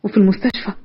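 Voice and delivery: usual speaking voice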